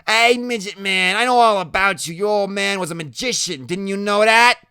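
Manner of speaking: high, loud, needling voice; Loretta, taunting. Acted. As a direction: taunt him loudly as Loretta.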